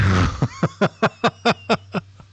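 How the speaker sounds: loud high disbelieving laugh; incredulous laugh. Real, not acted. Learner laughing at the AI voice, loud laugh.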